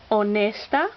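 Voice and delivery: honesta with the NES stressed; stress on second-to-last syllable. Shown as a demonstration. Teacher demonstrating a Spanish rule, stress on second-to-last syllable. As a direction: lean on the middle syllable of honesta. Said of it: "accent on the second to last syllable".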